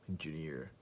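ordinary talk, nothing marked

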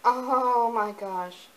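loud, slow, drawn-out oh my gosh; delighted surprise; thrilled. Real, not acted. Finding the newborn outfit, thrilled.

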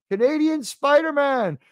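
high, loud happy welcome; excited greeting. Real, not acted. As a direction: greet a friend who just arrived. Greeting a newcomer in chat.

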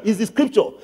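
ordinary delivery

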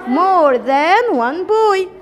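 very slow, word by word; teacher dictating the English sentence very slowly; slow dictation. Shown as a demonstration. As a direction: spell it out slowly for the class. Teacher gives the answer slowly.

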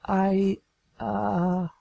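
high, halting, embarrassed mare voice; blushing, lost for words; flustered Trixie. Acted. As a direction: stammer it out high and slow. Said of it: "she trailed off, her face reddening".

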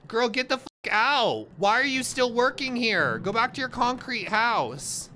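high, loud scolding after a fright; worked-up, high-pitched shouting; scared angry yelling. Real, not acted. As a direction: yell at a ghost to clear off. Yells at ghost after scare.

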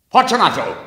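high, loud call into the wall; calling aloud. A narrator's reading. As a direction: shout the name into the niche. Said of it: "I called aloud".

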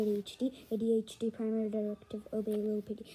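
low, quick monotone recital; droning directive. Acted. 80-HD reciting its primary directive, low quick drone. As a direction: drone it like a programmed machine.

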